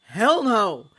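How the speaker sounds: loud, high defiant yell; shout. A narrator's reading. Gregson yelling his refusal to the crowd, full shout. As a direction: bellow it from the back of the crowd. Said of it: "shouted Gregson".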